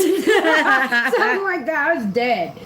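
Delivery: high, slow words through laughing; real laughter. Real, not acted. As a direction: let the laugh carry the words. Cracking up at the meme.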